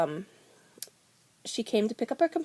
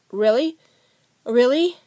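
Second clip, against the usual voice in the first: tearful joy; 5 semitones higher, 8 dB louder than usual. Retold or imitated. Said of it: "I literally started crying".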